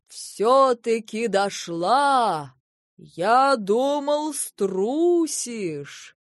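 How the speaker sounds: booming, slow, loud giant voice; thunderous voice. A narrator's reading. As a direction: roar it down from the sky. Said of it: "раскатился громовой голос".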